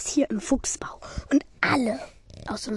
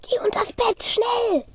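urgent shout; 10 semitones higher, 8 dB louder, much slower than usual. Pretend. Warning Ron to hide.

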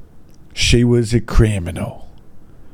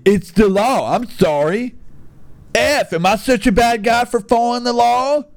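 mock poster voice; 10 semitones higher than usual. Mocking the racist poster.

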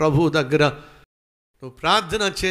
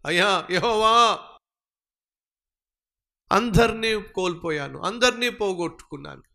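raised, pleading lament to the Lord; lamenting cry to God; 4 semitones higher than usual. Retold or imitated. David pleads to God in a raised lament. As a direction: cry out to God as a man who has lost everything. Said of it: "దేవుని దగ్గర విచారణ చేశాడట".

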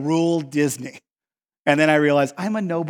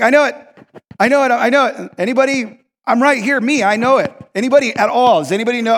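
loud, high, eager pleading; eager know-it-all; 5 semitones higher, 5 dB louder than usual. Retold or imitated. Competitive tour guest begging the guide to pick him, eager. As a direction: call out excitedly to get picked.

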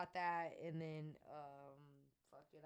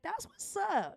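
hyped approval; 13 semitones higher, 5 dB louder, slower than usual. Retold or imitated. Retelling own reply to brother.

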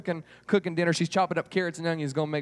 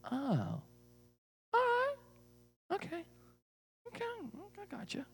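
tiny, high, very quiet okays; hesitant acceptance; 10 dB quieter than usual. Acted. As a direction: trail off small and high, unconvinced. Girl reluctantly accepts strange news.

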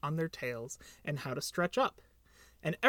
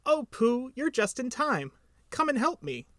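high, eager little voice; eager Piglet; 7 semitones higher than usual. A narrator's reading. Piglet greets Pooh and asks for help, eager high voice. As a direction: pipe up high, pleading for help.

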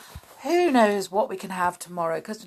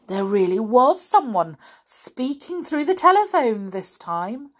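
plain reading voice

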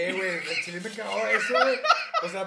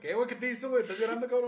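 teasing; 7 semitones higher, 6 dB louder, faster than usual. Real, not acted. Teasing friend about crying.

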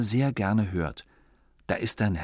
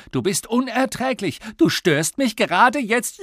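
high, loud exasperated yell; exasperated shouting; 12 semitones higher, 6 dB louder than usual. Acted. Father yelling at annoying son, high and loud. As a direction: scold the boy in full anger. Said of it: "hat Papa gerufen".

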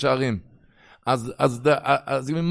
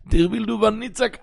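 usual speaking voice

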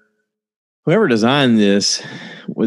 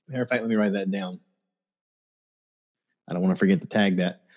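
muttered note to self, much softer and quick; softer, faster side remark; quiet aside; 3 semitones lower, 10 dB quieter, faster than usual. Real, not acted. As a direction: drop the voice, jot it down quickly. Aside to self, noting something.

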